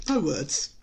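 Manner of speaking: low and moved, after a long silence; very emotional. Real, not acted. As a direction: at a loss, deeply touched. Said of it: "This is the most emotional broadcast".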